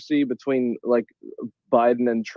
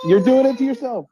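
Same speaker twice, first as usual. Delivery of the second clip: mock pleading; 11 semitones higher, 4 dB louder than usual. Mock pleading continues, amused.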